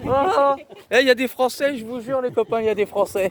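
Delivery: high, loud excited exclamation; thrilled to spot fellow French; excited surprise. Real, not acted. Surprised to meet French tourists.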